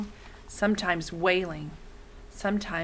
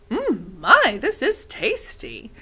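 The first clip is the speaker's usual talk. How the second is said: savouring food; 5 semitones higher, 4 dB louder, slower than usual. Acted. Missus Murdo enjoying breakfast.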